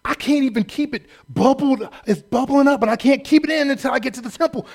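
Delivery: mock a showy Pharisee bubbling over. Preacher mocking a showy Pharisee for the crowd, hammed-up. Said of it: "what they were trying to show the people".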